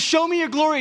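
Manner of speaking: high-pitched, fervent begging cry; eager plea. Acted. Moses asking to see God's glory, eager plea. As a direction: call it upward, pitched high.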